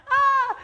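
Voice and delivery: sudden high yelp of fake panic; mock panic scream. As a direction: shriek like a startled worrier, played for laughs.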